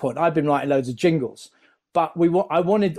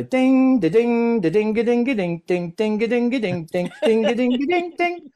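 sing-song ding-da-ding piano melody, pitched high; imitating piano; 8 semitones higher than usual. Describing the tape from Matt Lucas, mock piano plinks. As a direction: hum the solo piano tune as plinking dings. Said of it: "solo piano".

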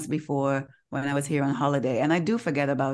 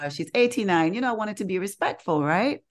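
ordinary talk, nothing marked

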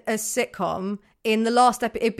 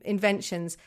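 ordinary talk, nothing marked

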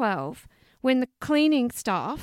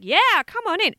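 mock cheery, high and loud welcome; imitated breezy, unashamed hospitality; proud welcome; 8 semitones higher, 7 dB louder than usual. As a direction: play a hoarder gladly inviting people in. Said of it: "they're even proud of their filth".

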